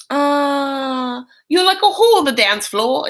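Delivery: a bad Cockney put-on; Cockney accent. Sister quoted in the soundbite, mock Cockney accent. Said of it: "I am not good at this accent".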